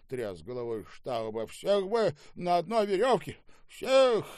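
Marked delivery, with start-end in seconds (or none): 1.5-4.2 high cry rising to a shout; outraged condemnation. Acted. Boss condemns the whole staff after the quarrel, outraged. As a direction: cry it out, hitting the last word loudest.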